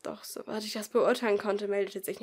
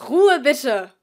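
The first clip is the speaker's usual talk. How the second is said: loud, higher call over the class; calling for quiet; 4 semitones higher, 16 dB louder than usual. Acted. Teacher calls the chattering class to order, loud. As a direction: silence a noisy room. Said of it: "rief Frau Meier".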